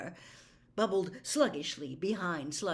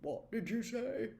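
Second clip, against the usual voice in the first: hoarse; 3 semitones higher, 5 dB quieter than usual. Acted. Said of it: "said hoarsely".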